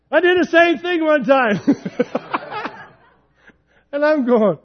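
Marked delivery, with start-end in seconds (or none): 0.1-1.6 high, loud laughing friend voice; a buddy cracking up as he confesses. Retold or imitated. Friend on phone, laughing, admits same mistake. 1.6-2.9 airless high giggle of a friend; high breathy laugh. Retold or imitated. Friend on the phone laughs at my story.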